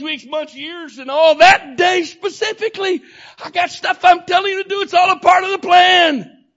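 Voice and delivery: loud, high, shouted complaint; preaching at full force. Acted. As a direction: cry it out to the church.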